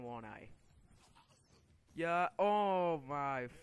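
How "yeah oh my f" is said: loud, slow, higher cry cut short; exasperated outburst. Real, not acted. Gamer about to die again, exasperated outburst. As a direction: drag out a furious curse and choke it off. Dies again, cuts off curse.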